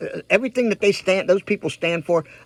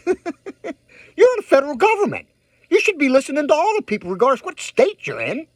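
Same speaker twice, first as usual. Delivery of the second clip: retold scolding; 6 semitones higher than usual. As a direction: lecture the official like a scolding teacher. Retells her reply to an official.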